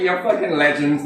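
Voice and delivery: ordinary delivery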